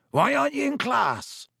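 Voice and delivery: cheery gamekeeper asking; Hagrid voice. A narrator's reading.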